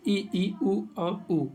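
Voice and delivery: slow, careful "ई, ई, उ और उ" each vowel drawn out; धीरे-धीरे स्वर उच्चारण; vowels spelled slowly. Shown as a demonstration. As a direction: sound out "ई, ई, उ और उ" one by one, slowly. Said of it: "मुंह कम खुला हो".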